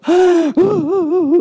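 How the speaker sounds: loud, high imitated wail; mock wailing sob. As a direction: sob aloud in a hammed-up lament. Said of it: "통곡을 하면서 집에서 우는".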